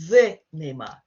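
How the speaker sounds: the Neymar, with a proud weight on the; stress on the. Shown as a demonstration. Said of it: "it sounds quite a big-headed".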